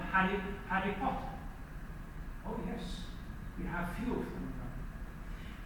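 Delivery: usual speaking voice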